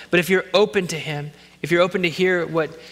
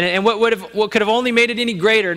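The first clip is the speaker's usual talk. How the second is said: normal talking voice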